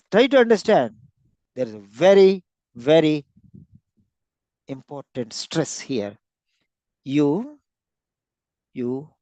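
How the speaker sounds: ordinary talk, nothing marked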